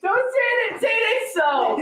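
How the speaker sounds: high, laughing teasing shout. Real, not acted. Co-host echoing the host's plea, teasing high shout. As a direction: echo him back to tease.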